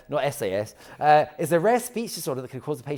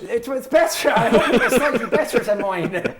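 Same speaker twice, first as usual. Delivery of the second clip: high mock Irish through laughter; bad Irish accent; 7 semitones higher, 5 dB louder than usual. Guest answers host's jibe in bad mock Irish. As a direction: defend yourself in a hammed-up Irish accent, laughing. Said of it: "was it as bad as yours".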